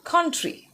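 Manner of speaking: COUNTRY said high with clear KUN-tree; model pronunciation. Shown as a demonstration.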